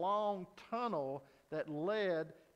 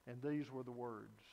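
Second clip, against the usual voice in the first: ordinary delivery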